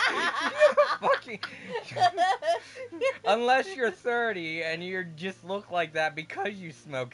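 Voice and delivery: loud, high laughing outburst; laughing hard. Real, not acted. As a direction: shout it through laughter. Mocking granny, cracking up.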